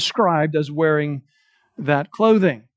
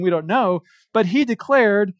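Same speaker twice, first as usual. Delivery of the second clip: ordinary talk, nothing marked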